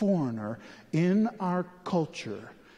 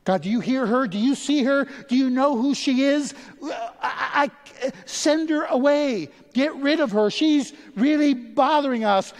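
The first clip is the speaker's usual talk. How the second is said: loud, high exasperated begging; pleading disciples; 12 semitones higher, 8 dB louder than usual. Retold or imitated. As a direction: beg God to get rid of her, louder and higher. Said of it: "his disciples came and they begged him".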